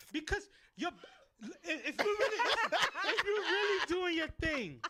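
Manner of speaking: giggling through the words; laughing. Real, not acted. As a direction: laugh while you explain. Laughing while telling embarrassing story.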